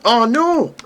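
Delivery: high, loud scream of dismay. Real, not acted. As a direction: shriek as it goes wrong. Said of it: "grunting and screaming and abuse".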